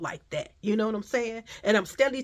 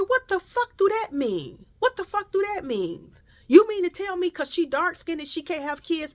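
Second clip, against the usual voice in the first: indignant challenge; 10 semitones higher than usual. Retold or imitated. Partner confronting the lady over his kids, indignant. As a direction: imitate an affronted man, pitched way up.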